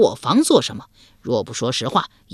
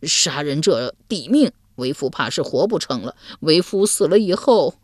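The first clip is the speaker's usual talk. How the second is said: usual speaking voice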